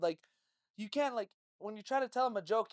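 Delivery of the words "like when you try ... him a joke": usual speaking voice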